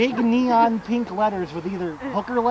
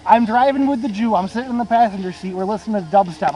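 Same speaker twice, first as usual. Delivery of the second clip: ordinary delivery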